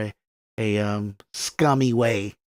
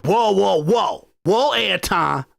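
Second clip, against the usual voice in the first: mock scolding woman; 5 semitones higher, slower than usual.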